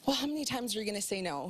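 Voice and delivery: ordinary delivery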